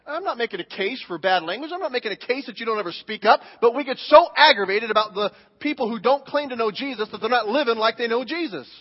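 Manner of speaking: ordinary delivery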